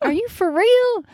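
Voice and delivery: high, astonished voice cracking; amazed driver. Retold or imitated. Driver amazed to see a nun, pitched high. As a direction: gasp it out in disbelief. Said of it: "And he's like".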